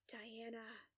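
weary, whispered sigh; soft exhaled whisper after a sigh. A narrator's reading. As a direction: let out a sigh, then whisper the name. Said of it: "He sighs".

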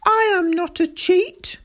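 high, loud outburst of protest; furious shout. A narrator's reading. Boy shouting back at teacher, furious. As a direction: blurt it back in blind fury. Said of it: "I shouted back at him".

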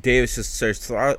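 normal talking voice

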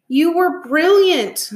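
high, loud, slow exclamation; drawn-out, delighted congratulation; gushing praise. A narrator's reading. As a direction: gush with pride, stretching the words. Dad praises daughter after her play.

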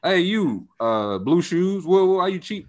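single someone out teasingly, as a club announcer would; mock calling-out. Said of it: "they will call you out".

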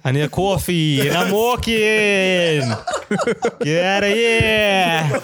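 high, slow hammed-up New York guy; exaggerated New York complaint; New York accent. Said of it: "Do a New York guy".

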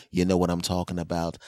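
normal talking voice

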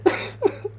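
voiceless, breathy laugh held in; silent laugh. Retold or imitated. Boy stifles his laughter in class, laughing silently. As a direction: laugh with no voice, trying not to be heard. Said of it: "pero en silencio".

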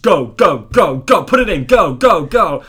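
loud urgent chant of go; urgent shouting. Retold or imitated. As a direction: yell go go to push through fear. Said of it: "it gets to the point".

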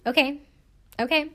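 calm flat affect. Retold or imitated. Podcaster mimicking a bride brushing off her groom, flat affect. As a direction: imitate blank, detached acknowledgement. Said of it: "like, calm".

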